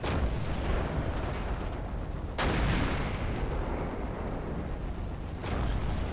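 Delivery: breathless wheezing laugh; shaking with silent laughter; long silent laugh. Real, not acted. Friend's joke about Nazi names.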